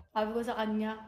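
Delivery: ordinary talk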